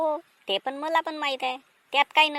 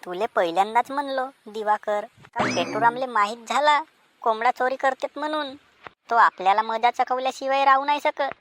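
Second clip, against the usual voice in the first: normal talking voice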